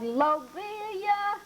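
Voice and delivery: high, slow call across the weeds; boy calling his cat across a vacant lot, high and drawn out; yelling for cat. Acted. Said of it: "I yelled".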